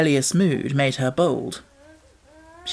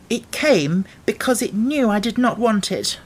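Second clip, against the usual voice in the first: put-on higher, petulant girl's voice; sulky child; 7 semitones higher than usual. A narrator's reading. Mary sulking at the rain in bed, petulant. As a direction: sulk at the rain.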